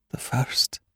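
unvoiced, frightened whisper. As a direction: breathe it out in fear. Frightened woman admits her dream.

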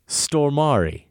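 "Stormari" said slowly, ending like "[name]"; Swedish pronunciation. Shown as a demonstration. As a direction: draw out "Stormari" high, the Swedish way. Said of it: "pronounced like the end of [name]".

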